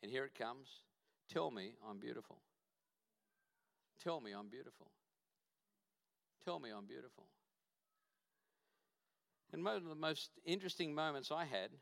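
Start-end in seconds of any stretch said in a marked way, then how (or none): 1.3-7.3 hushed, aching request to be told she's beautiful; soft plea. Retold or imitated. Daughter quietly begging her father to affirm her, hushed. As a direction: let it fall to almost nothing, pleading.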